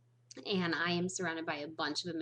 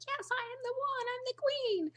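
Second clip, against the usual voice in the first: mock triumph. Triumphing on a cooking show.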